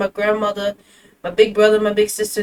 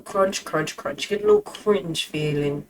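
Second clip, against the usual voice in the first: imitating deep voice; 4 semitones lower, 6 dB quieter than usual. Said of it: "His voice is awesome too".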